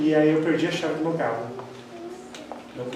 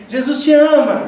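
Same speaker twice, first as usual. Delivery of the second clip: loud, high call through the open window; 9 semitones higher, 9 dB louder than usual. Retold or imitated. Driver calls across to a couple in the next car, loud and high.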